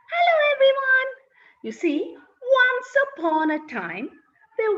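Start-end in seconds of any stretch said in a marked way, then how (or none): 0.1-1.1 cheerful high call-out to the class; excited greeting. Real, not acted. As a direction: greet them brightly and big. Storyteller greets children loudly.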